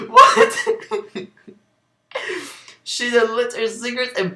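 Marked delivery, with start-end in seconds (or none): 0.1-1.3 squealing high laugh on the word; laughing what. Real, not acted. Reading the fanfic aloud, bursting into laughter. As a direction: crack up mid-question, high and loud.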